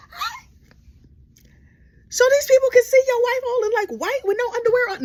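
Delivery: very high disbelieving squeak; shocked huh. Real, not acted. Comedian horrified by the baptism plan, high and shrill. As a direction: screech it out in disbelief.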